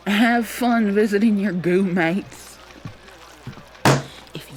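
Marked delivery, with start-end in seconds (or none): 0.1-2.6 slow mocking jeer through a laugh; sneering coworker, slow and laughing; mocking taunt. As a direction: taunt her with a laugh, drawn out. Coworker mocks her.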